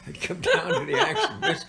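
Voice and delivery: high, loud words through laughter; laughing while talking. Real, not acted. Laughing while retelling drunk chopping.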